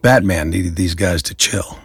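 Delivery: plain reading voice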